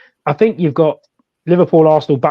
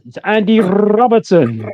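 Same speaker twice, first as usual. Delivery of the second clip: rolled R in Robertson, slow and higher; Spanish commentator; 5 semitones higher, slower than usual. Retold or imitated. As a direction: imitate a Spanish FIFA commentator rolling the R in "Robertson". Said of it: "the roll of the R's".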